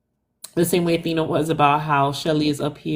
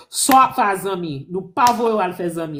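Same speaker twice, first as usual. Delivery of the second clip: a Haitian auntie scolds in Creole; Haitian Creole scolding; 2 semitones higher than usual. Retold or imitated. Haitian family scolding her about friends, in Creole. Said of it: "her real Haitian people, to tell her".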